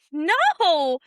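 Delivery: loud, high-pitched retold brush-off; exclaimed refusal. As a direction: act out sending him away, loud and drawn out. Retold refusal to a pushy suitor.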